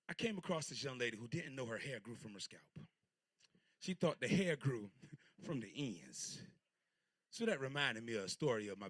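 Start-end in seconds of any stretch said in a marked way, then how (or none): none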